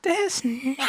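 her exasperated reaction. Retold or imitated. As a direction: sigh it out as the fed-up girlfriend. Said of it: "She was like".

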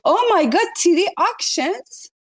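high-pitched, stretched-out exclamations; gushing, amazed oh-my-God; astonished delight. Real, not acted. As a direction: react in thrilled disbelief. Astonished at news of a sale.

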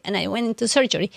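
ordinary talk

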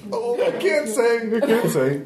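high, drawn-out refusal; coy painter. Acted. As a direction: hold back teasingly in a high voice. Game master plays coy painter.

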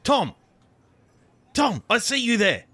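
high call across the lot. Acted. Visitor spotting Tom and hailing him, a high call.

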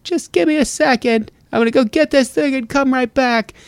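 high, polite fibbing cat. Retold or imitated. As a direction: squeak it out like a cat making excuses. Cat excusing itself.

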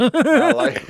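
high laughing squeal; burst of laughter. Real, not acted. Cohost cracking up at the Nazi-neck joke, laughing loud.

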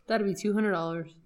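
ordinary delivery

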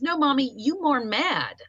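lightly voiced little boy; child's words. Mother voicing her toddler son, lightly childlike. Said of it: "That's how he said it".

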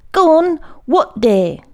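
high, loud prompting from a stern teacher; impatient schoolmaster. A narrator's reading. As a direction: prod the faltering pupil sharply. Priest prods a faltering pupil.

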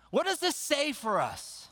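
usual speaking voice

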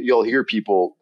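normal talking voice

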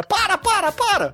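very high, hammy TV host call; shrill mock host yelling stop; João Kleber. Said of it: "tipo o João Kleber".

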